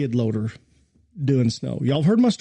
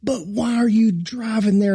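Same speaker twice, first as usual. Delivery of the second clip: mock scream; 8 semitones higher than usual. Pastor retelling the groundsman screaming at him, mock yell. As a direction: demand it like the furious groundsman. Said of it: "he's just screaming".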